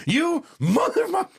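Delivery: high, loud incredulous outburst; half-laughing fake fury at the kid; father reacting to son's confession, mock outrage. Kid admits hiding the watch.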